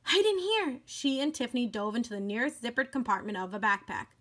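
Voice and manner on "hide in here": higher urgent call; urgent hide call. Acted. Doll urges her friend to hide in the backpack, urgent. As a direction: hurry her into the pocket. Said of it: "cried Annabelle, alarmed".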